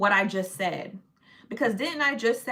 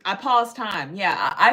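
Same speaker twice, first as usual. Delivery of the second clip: ordinary delivery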